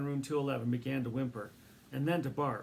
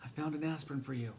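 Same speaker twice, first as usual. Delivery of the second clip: quick, eager little mouse voice; small mouse voice; 3 semitones higher, faster than usual. A narrator's reading. Mouse Ralph voiced in story.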